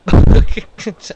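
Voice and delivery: loud, high burst of laughter. Real, not acted. Player after a near disaster, laughing loudly.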